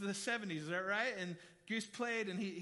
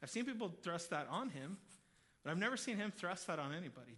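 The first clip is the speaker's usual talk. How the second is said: ordinary delivery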